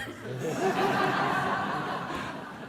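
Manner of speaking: a sudden high burst of laughter; real laugh. Real, not acted. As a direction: laugh out before you can stop it. Unexpected laugh during the welcomes.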